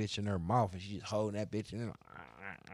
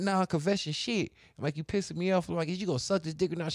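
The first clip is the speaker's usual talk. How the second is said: high-pitched retold irritation; mock exasperated argument; getting mad; 10 semitones higher, 3 dB louder than usual. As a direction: protest in a raised pitch, playing up annoyance. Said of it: "Now I'm getting mad".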